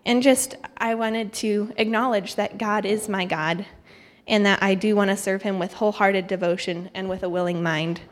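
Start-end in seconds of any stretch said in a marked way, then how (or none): none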